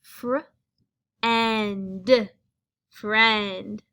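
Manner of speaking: fr, and, friend blended step by step; blending sounds. Shown as a demonstration. Teacher to class, blending sounds into friend. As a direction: blend the parts into friend, building up. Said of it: "when we put these together".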